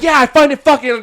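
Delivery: high, loud angry comeback; heated shout of defiance; pissed off. Retold or imitated. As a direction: re-enact snapping back at a cop, voice way up. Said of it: "I just got so pissed".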